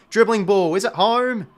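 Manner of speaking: loud, rising hopeful call; excited hope. Real, not acted. Fan willing the dribbling ball home, loud and excited. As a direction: lift up as the ball rolls. Fan watching shot at goal.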